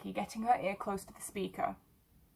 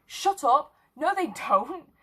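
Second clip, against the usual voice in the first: loud, higher playful protest; mock protest; 6 semitones higher, 6 dB louder, slower than usual. A narrator's reading. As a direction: fake outrage at a friend's joke. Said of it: "in feigned protest".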